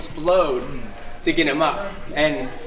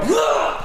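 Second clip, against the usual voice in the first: krótkie stęknięcie z wysiłku; sharp effort grunt; 13 semitones higher than usual. Shown as a demonstration. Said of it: "I go".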